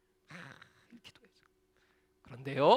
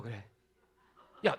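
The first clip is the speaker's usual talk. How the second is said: ordinary talk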